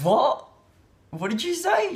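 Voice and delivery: high, loud startled what; shocked disbelief. Retold or imitated. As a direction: react as if you misheard something absurd. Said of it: "And I went".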